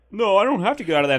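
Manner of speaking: high, loud, fast mock refusal; hammed-up defiant driver, rushing the words; defiant protest. Driver refusing to get out of the car, high loud protest.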